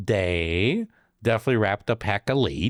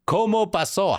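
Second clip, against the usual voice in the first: high, sing-song Spanish question; playful fake Spanish; mock Spanish; 11 semitones higher than usual. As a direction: put on a pitched-up Spanish lilt.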